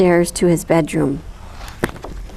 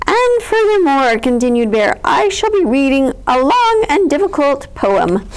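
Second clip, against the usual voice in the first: pompous bear; 4 semitones higher, 4 dB louder than usual. A narrator's reading.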